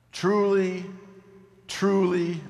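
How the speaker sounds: each Truly high, loud and set apart; high, loud stress on both Truly; emphatic repetition. Acted. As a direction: proclaim Truly with weight, pausing after each. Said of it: "an emphatic statement".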